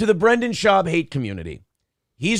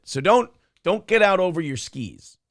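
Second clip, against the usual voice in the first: ordinary delivery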